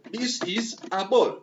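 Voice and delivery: teacher slowing down to model the line; slow, deliberate demo. Shown as a demonstration. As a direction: say it slowly so the class can follow.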